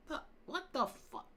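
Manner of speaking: ordinary talk, nothing marked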